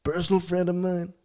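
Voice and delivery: drawn-out Trump superlatives; Trump impression. Guest hyping a player as Trump, closing with folks. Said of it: "About in the Trump voice?".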